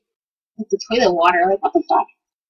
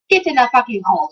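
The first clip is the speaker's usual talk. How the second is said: ordinary delivery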